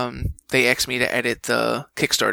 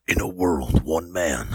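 much lower, booming trailer announcer; movie trailer voice; 8 semitones lower, slower than usual. Shown as a demonstration. Showing the hosts the trailer voice he rejected, deep and booming. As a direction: rumble it like an epic film trailer. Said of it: "I couldn't do that either".

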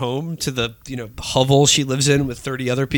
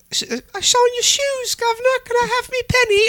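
high, bright Cockney urchin squeak; cheeky scrappy street kid. Retold or imitated. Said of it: "she's like".

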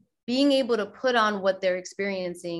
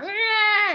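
startled ah; 13 semitones higher, 5 dB louder than usual. Retold or imitated. Kid acting out, adult reacts.

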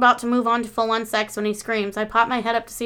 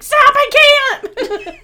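high shrieked Stop, cracking into laughter; laughing shriek; 10 dB louder than usual. Retold or imitated. Friend overwhelmed by the song, shrieking and laughing. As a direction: yell it much higher and louder, then break up laughing. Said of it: "And she's like".

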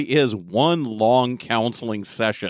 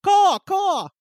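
high squawking crow call; bird caw. Acted. Creator known as great bird punctuates his rant, cawing. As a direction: screech like a big bird.

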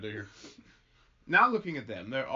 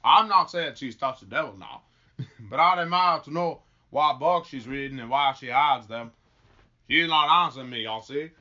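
earnest, emphatic rustic delivery; folksy farmer; 8 dB louder than usual. Acted. Farmer wonders at wife's hidden books, folksy. Plays farmer in put-on drawl.

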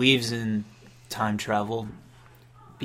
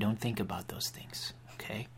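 soft guru whisper; 10 dB quieter than usual. Acted. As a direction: whisper it soothingly. Soothing prayer leader.

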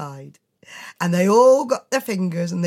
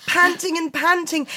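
breathless; 9 semitones higher than usual. A narrator's reading. Breathless narration.

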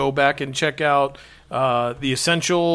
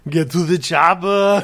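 slow, louder Arnold impression, pitched up; Arnold Schwarzenegger; 3 semitones higher, 4 dB louder than usual. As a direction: growl it like an action hero. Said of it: "Arnold Schwarzenegger".

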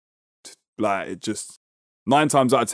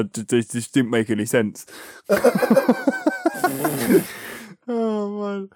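normal talking voice

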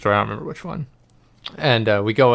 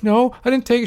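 put-on much higher, small frightened kid denying it; little kid voice; 13 semitones higher than usual. Denying it to mom at the kitchen table, in a high scared kid voice. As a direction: squeak it out like a scared five-year-old.

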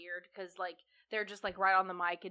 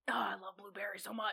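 whispering; 4 dB quieter than usual. Real, not acted. ASMR taste test whisper.

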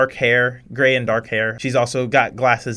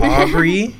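director name repeat; 7 semitones higher, 7 dB louder than usual. Acted. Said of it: "She doesn't stutter like that".

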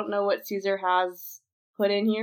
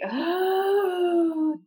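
sharp gasp; 11 semitones higher, 5 dB louder than usual. Real, not acted. Frustrated gasp.

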